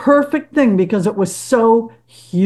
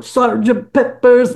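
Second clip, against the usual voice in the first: put-on English accent. Band member imitating the album's English-accented vocal. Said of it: "in a very English sounding voice".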